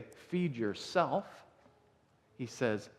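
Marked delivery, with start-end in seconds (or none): 0.0-1.5 "Feed yourself" drawn out slowly; slow, pointed "Feed yourself"; slow mock counterexample. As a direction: spell out the wrong idea slowly. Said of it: "He doesn't say".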